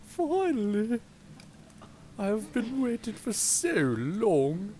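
high, slow, swooning Southern belle; loud hammed-up Southern belle lament. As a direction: draw it out like a theatrical Southern belle. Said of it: "Southern belle".

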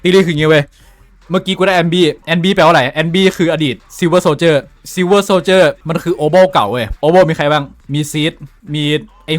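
talking very loud. Real, not acted. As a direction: hammer the point loudly at chat. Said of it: "กูพูดเสียงดังมาก". (พูดเสียงดังมากใส่แชท)

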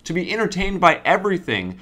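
high, whiny mock of an entitled viewer; lightly voiced entitled brat. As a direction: ham up a spoiled viewer. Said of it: "because they're like".

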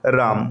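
राम with the vowel held long; low, sustained राम; drawn-out vowel. Shown as a demonstration. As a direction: stretch the middle of राम as in a call. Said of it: "काफ़ी देर तक चलता रहेगा".

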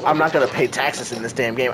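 usual speaking voice

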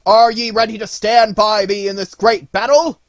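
high, loud, drawn-out call to arms; rallying cry. A narrator's reading. Nutcracker rallying his vassals with drawn sword, loud heroic cry. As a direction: shout to rouse troops for battle. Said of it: "waved it in the air, and cried".

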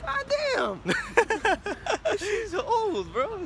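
high, loud amazed outburst with laughter; amazed shout. Real, not acted. Amazed at university's age.

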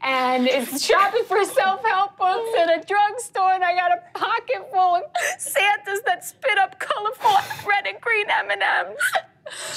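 high, fast crying rant; tearful rant. Acted. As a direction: pitch it up, half-sobbing. Character breaks down ranting.

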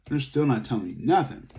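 ordinary talk, nothing marked

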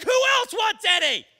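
high loud shout; high shouted threat; wild challenge. Acted. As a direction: bellow at the whole camp. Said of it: "He's like".

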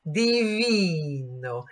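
divino slow, with a bright ee, cheeks lifted; bright Italian E. Shown as a demonstration. Coach modelling a bright ee for singers. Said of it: "I like to have a bright E vowel".

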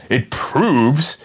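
loud furious burst; sudden blast of fury. A narrator's reading. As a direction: explode into rage. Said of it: "with a sudden blast of fury".

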